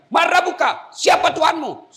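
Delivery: high, loud shout; thundering interrogation. Acted. Angel questioning the dead in the grave, thundering shout. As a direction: demand answers like a stern grave angel.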